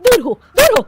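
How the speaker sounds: loud, high shout of disgust; recoiling from the drunk bride's breath, loud disgusted shout. Acted. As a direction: shoo her off with a shriek.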